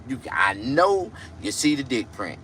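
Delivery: ordinary delivery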